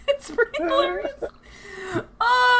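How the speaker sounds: high, through a burst of laughter; laughing. Real, not acted. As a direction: crack up mid-sentence. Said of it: "repeats through laughter".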